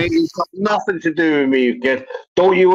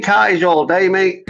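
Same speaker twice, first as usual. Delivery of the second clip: ordinary delivery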